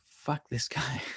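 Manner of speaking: hushed mock whisper from imagined viewers; whispered jab. Imagined offended viewers muttering about the streamer, whispered. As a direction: breathe it out as an offended aside.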